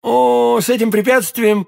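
breezy confidence. A narrator's reading. Confident reassurance after brief thought.

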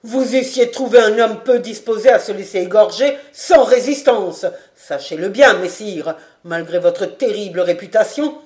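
loud, defiant warning; energetic threat. A narrator's reading. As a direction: stand firm and warn him. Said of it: "avec énergie". (avertissement énergique et menaçant)

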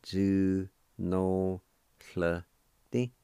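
"zu no hle di" in separated, held syllables; syllable-by-syllable pronunciation. Shown as a demonstration. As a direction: sound out "zu no hle di" piece by piece. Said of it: "second part".

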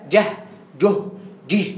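"جه، جه، جه" said jah, juh, jih; three short vowels. Shown as a demonstration. Teacher listing model answers, "جه" as jah, juh, jih. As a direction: step "جه" through a, u, i, each closed by h. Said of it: "مفتوحة، مضمومة، مكسورة في الإجابة".

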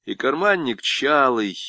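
usual speaking voice

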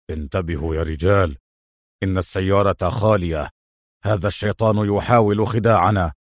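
low-voiced henchman in charge; wary gang leader. A narrator's reading. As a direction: warn your men in a deep, guarded voice. Gang leader warns his men. (زعيم عصابة حذر بصوت منخفض)

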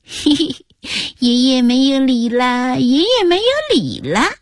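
put-on high singsong little-girl chant; gleeful taunting child. A narrator's reading. Granddaughter jumps up teasing grandpa, gleeful singsong taunt. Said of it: "孙女儿更高兴地跳起来说".

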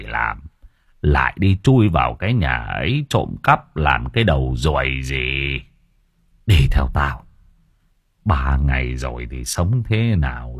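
put-on old man scolding the boys while giving in; grumbling old man. A narrator's reading. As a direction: grumble and scold like a weary grandfather. Old man voiced in story.

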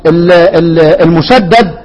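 ordinary delivery

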